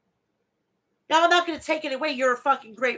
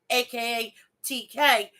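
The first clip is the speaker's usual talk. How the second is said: ordinary delivery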